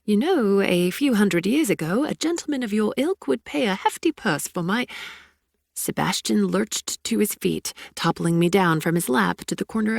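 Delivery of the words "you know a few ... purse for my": put-on playful BBC newsreader accent, a bit higher; BBC anchor accent. Abigail joking to Sebastian in a BBC anchor accent. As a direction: quip it like a British news anchor. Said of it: "my best B B C anchor accent".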